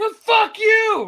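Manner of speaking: very high, loud, drawn-out holler; comic shouted retort; yelled comeback. Retold or imitated. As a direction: shout it back across the distance. Other man yells insult back.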